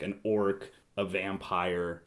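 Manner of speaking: usual speaking voice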